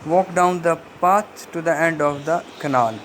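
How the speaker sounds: very slow, each stressed word set apart; slow stress-timed example sentence; rhythm demo. Shown as a demonstration. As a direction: read "Walk down the path to the end of the canal" slowly, leaning on the strong words. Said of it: "नीचे एक example दी गई है".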